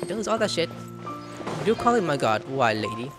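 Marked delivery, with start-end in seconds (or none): none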